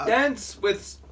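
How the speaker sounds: high, loud, sour-struck complaint; pained high exclaim. Real, not acted. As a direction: blurt it out, mouth burning. Reacting to sour acid.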